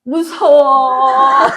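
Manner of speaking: put-on slow drawn-out whine of a scared toddler; whiny scared child. Grandchild whining at grandma, slow mock whimper. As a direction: stretch it out like a grandchild pouting. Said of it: "이러면".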